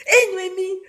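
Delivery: high, sing-song call; imitation de la tantine. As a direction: greet her like an auntie on the phone. Tantine imitée au téléphone. (la tantine qui appelle, voix haute et chantante)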